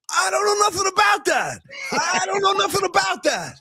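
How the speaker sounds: high, shouted mob witness voice; Frank Pantangeli impression. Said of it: "as Frank Pantangeli said on Trial and Godfather Two".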